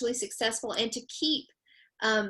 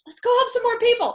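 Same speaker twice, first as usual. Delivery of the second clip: much higher, louder excited cheer; 14 semitones higher, 9 dB louder than usual. Retold or imitated. Co-host rallying to help more families, excited. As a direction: burst out loud and high with excitement. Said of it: "I get so excited".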